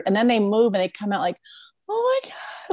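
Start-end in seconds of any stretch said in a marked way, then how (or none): 1.9-2.7 very high, squeaky-pitched exclamation; imitated overwhelmed awe. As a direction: voice a dancer suddenly overcome. Voices dancers overcome after moving.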